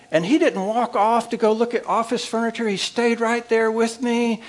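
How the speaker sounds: wife bragging to her mother, high and thrilled; excited wife on phone. Retold or imitated. Wife voiced excitedly phoning mother.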